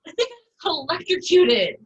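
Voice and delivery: panicked. Acted. Just electrocuted, panicking.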